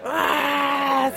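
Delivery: a high mock yell of a man waking with a start; imitated scream. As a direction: yelp it as he jumps up shouting. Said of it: "se levantó gritando". (grito imitado, agudo y de susto)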